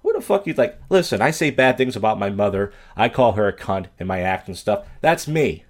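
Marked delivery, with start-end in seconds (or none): 0.0-0.5 high-pitched indignant squawk; who the hell are you, pitched way up; outraged. Retold or imitated. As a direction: snap back in disbelief. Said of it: "looking at her like".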